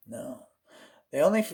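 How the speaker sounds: ordinary talk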